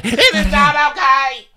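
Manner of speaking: suddenly high and loud, hammed up; mock outburst. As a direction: blurt it out like a sudden meltdown. Acted outburst after calm words.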